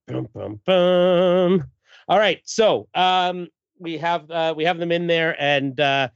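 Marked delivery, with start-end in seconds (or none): 0.1-1.6 mock dramatic sting. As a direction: sound out a suspense sting, building. Spoken dramatic music sting.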